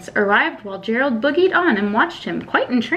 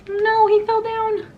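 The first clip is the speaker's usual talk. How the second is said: mock dismay; 10 semitones higher, slower than usual. Teacher's aside on Gerald falling.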